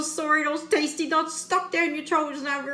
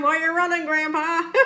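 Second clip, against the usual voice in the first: ordinary talk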